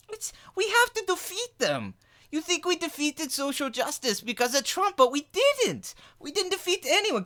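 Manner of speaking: very high, straining put-on voice; alarmed ranting caricature. Impressionist as a streamer insisting social justice must be defeated, high frantic caricature. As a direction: plead in a high, overwrought pitch.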